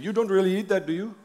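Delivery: put-on quoting an Indian friend, accent. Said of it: "I wanna quote him, but I don't wanna insult anyone with an Indian accent".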